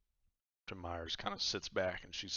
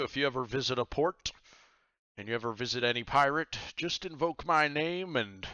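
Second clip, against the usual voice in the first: pirate captain; 3 semitones higher, 6 dB louder than usual. Acted. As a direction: boom it out like a cheery pirate host. Captain Myers voice again.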